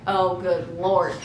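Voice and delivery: low, drawn-out sigh of dismay; exasperated. Retold or imitated. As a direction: drag it out wearily, low. Exasperated reaction quoted in the story.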